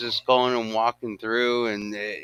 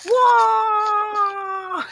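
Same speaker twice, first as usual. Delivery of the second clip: long, high, loud whooping scream; high-pitched "whoa"; 6 dB louder than usual. Retold or imitated. Retelling a meme of a gorilla on coke, high wild whoop. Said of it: "and he was like".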